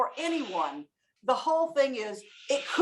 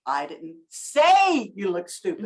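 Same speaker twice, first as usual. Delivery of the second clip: slow, with say stretched out long; stress on SAY; 4 semitones lower, slower than usual. Shown as a demonstration. Trainer demonstrates an audience member's take, stress on say. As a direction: hit say hard and hold it. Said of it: "all the emphasis on the word say".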